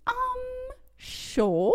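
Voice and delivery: drawn-out, doubtful acceptance; mock-uncertain, stretched-out reply; hesitant agreement. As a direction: agree reluctantly after an awkward pause. Retold reluctant agreement to odd request.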